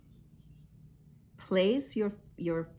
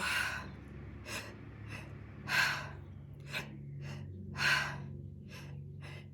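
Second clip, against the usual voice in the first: slow audible exhale through the mouth; long breathy exhale; 8 dB quieter than usual. Shown as a demonstration. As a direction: let all the air out in one long sigh. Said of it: "and then you go".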